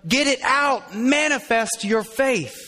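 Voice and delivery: high-starting, emphatic order; stern, drawn-out exhortation; forceful command. Shown as a demonstration. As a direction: demand it firmly, stretching the final words.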